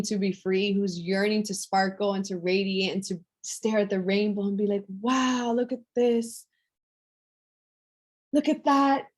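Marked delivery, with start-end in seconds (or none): 5.0-9.1 put-on higher, wondering child's exclamation; awed little girl. Coach voicing little girl staring at rainbow, awed. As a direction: marvel like a curious little girl. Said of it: "She's in awe, she's curious".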